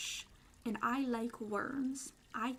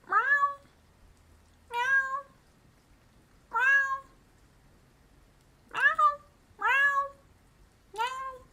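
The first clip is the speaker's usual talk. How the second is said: high, loud yowling meows like a cat; 13 semitones higher, 11 dB louder, much slower than usual. Pretend. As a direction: meow like a shrieking kitten.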